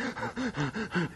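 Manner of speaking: a short, hard intake of breath, soft; imitated sharp gasp. Lecturer imitating the pilot's breathing mid-fight, a sharp gasp. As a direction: copy a pilot straining under G's. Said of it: "but he's like".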